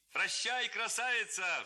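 high, loud mocking farewell call; shouting lad. A narrator's reading. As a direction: yell it out teasing. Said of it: "вскричал другой". (парубок громко выкрикивает прощание)

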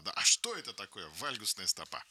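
usual speaking voice

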